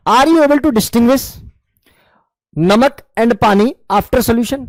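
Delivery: very slow, each word spaced; deliberate teacher dictation. Shown as a demonstration. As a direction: draw out the question for the class. Teacher dictates question slowly.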